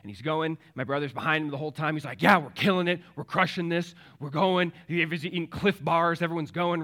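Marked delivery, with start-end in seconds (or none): 2.2-4.7 loud, raised, gung-ho cheerleading; loud retold trail hype; pumped-up brother. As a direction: imitate someone hyped mid-climb.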